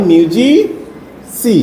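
মিউজি-সি spelled out very slowly; slow sounding out. Shown as a demonstration. Teacher slowly builds the word for the pupil. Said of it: "M U S I".

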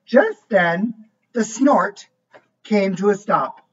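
lively storybook voice, each line set apart; reading to a child. A narrator's reading. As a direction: read it like a bedtime book for a little one. Reads picture book aloud.

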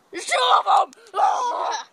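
high loud panicked yell; panicked shout. Real, not acted. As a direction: yell in fright. Ants on him.

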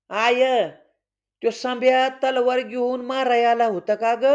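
a worried little brother asking; child voice. Acted. Little boy asks mother. (लहान मुलाचा आवाज)

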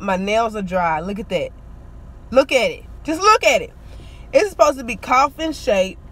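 normal talking voice

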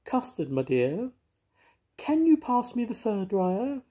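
a theatrical cat asking sweetly; Moggy the grand cat. Acted.

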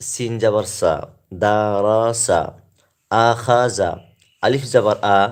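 loud, rhythmic sing-song spelling drill; spelling recitation. Shown as a demonstration. Teacher spelling Arabic words aloud to viewers, rhythmic drill. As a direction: chant each syllable crisply so learners can follow. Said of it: "পাঠ করছি, আরবি উচ্চারণগুলি বানান করে পড়ছি".

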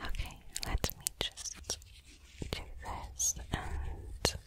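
very soft, barely voiced whisper; murmuring to self. Medic working on a patient's wounds, murmuring to self. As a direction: think aloud under your breath while working.

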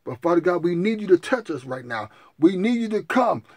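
loud pleading prayer; loud, repeated demanding plea; fervent prayer. Acted. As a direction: storm heaven with the questions.

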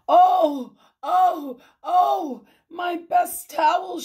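high, loud cries of distress; hammed-up, high-pitched dismay; dismayed wail. A narrator's reading. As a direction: wail each oh in acted horror. Mrs Rogers wailing over ruined towels.

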